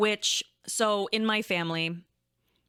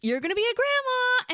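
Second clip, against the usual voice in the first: giddy repeat; 14 semitones higher, 3 dB louder than usual. Retold or imitated. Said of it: "And I'm like".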